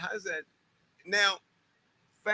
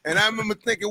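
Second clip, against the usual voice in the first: ordinary talk, nothing marked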